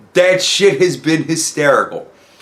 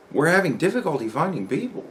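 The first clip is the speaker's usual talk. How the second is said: softer, swooping questioning tune; incredulous echo; 8 dB quieter than usual. Retold or imitated. Coworker questioning the company email, incredulous echo. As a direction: echo it back as a doubtful question. Repeats email line in disbelief.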